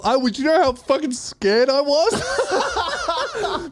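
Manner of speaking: high, drawn-out words breaking into laughter; real laughter creeping into the voice; laughing exclamation. Real, not acted. Laughing at his own past fright.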